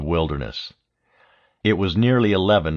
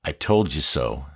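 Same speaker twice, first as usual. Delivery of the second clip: Stark's silent smug look given voice; smug I told you so; 4 semitones lower than usual. A narrator's reading. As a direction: let it sit, knowingly smug. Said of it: "as if to say".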